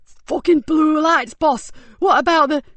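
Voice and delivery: high, loud panicked cry; panicked thug. A narrator's reading. Thug crying out to his boss in panic. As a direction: blurt it out in alarm.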